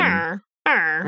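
low grunted character noise; one-syllable Ron. Acted. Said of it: "one syllable impression".

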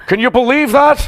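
high, loud mock outrage; incensed. Comedian retelling his friend's angry phone calls, high-pitched mock indignation. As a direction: imitate an incensed man griping to his friends. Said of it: "he was, he was incensed".

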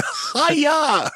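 high mock kid's yell of hi-ya; imitating son's yell. Dad retelling his son yelling from the bedroom, high mock yell. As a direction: shout it up high like a toddler doing kung fu. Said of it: "I just heard my son yelling".

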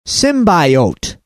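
"Symbiote" with a long I, SIM-bye-oat; mispronounced symbiote. Retold or imitated. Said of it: "It's not pronounced symbiote".

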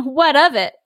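mildly defiant comeback; tough street kid. A narrator's reading. Aladdin challenges the stranger, mildly tough. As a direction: push back like a little gangster. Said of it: "like, a little street gangster".

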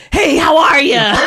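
high, slow goofy greeting; chirpy mock friendly hello; Lambert impression. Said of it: "Lambert just goes".

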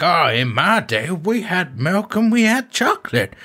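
put-on high, old-timer prospector voice; grizzled old prospector reminiscing. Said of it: "being an old prospector".

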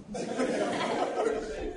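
a soft, high airy chuckle; high breathy laugh. Real, not acted. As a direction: laugh quietly at the crowd's answer. Preacher laughs at crowd.

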